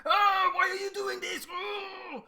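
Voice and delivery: high, strained outburst yelling at someone; angry yell. Acted. Speaker yelling at someone when overcome by anger, angry yell. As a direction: yell it high, losing your temper. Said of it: "I get really angry ... I just yell at them".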